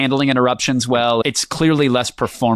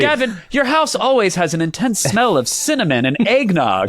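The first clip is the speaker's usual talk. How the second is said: laughing; 7 semitones higher than usual. Real, not acted. Teasing co-host, laughing.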